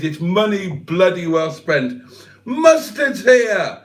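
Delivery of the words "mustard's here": drawn-out greeting. Real, not acted. Greeting a viewer arriving.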